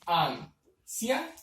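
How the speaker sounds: AN-CIA-NOS, sílaba por sílaba, muy lento; dividido en sílabas. Shown as a demonstration. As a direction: separate "Ancianos" into slow syllables, pausing between each. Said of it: "¿Cómo se divide esta palabra?". ("Ancianos" silabeado despacio, con pausas)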